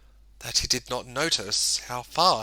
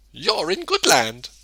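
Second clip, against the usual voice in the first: friendly woman; 14 semitones higher than usual. A narrator's reading. The woman answers.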